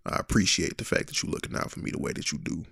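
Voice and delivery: hushed mimic of partner's thank-you; soft, breathy take-off of her voice; imitating partner's voice. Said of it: "I always say it in your voice".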